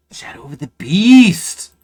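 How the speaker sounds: loud, high, drawn-out announcement; big title reveal. Real, not acted. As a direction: build up and belt out the last word slowly. Announces the chosen game's title.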